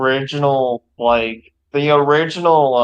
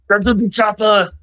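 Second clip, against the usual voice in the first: Arnie voice; 7 semitones higher than usual. Pretend. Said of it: "movie quote".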